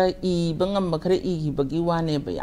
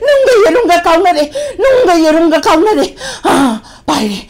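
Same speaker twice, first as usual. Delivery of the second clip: possessed oracle; 13 semitones higher, 13 dB louder than usual. Shown as a demonstration. Artist demonstrates an oracle from a radio play, frenzied wailing. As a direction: cry out frenzied omens, gasping and cackling between lines. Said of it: "ꯂꯥꯏꯕꯥꯎꯒꯨꯝꯕ".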